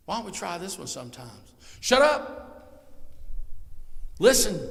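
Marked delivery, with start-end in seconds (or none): 1.8-4.6 shouted commands, much higher and louder; sudden shout. Shown as a demonstration. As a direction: bark it out for a laugh. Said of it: "That's meditation".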